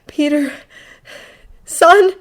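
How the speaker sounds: high, spaced-out pleading; desperate. A narrator's reading. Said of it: "he thinks desperately".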